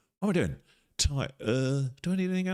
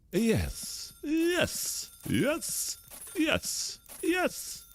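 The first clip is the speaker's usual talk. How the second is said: much higher squeaky yes, over and over; stuck on repeat; much slower than usual. Pretend. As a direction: chirp it like a stuck record. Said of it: "On repeat, on repeat".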